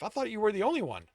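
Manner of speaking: quick, high, incredulous; disbelief. A narrator's reading. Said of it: "Stowe asked in disbelief".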